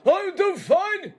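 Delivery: high, slow, wailing character voice. Acted. Host calls up to Oz, who answers in a high drawn-out wail. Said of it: "I mean, Oz?".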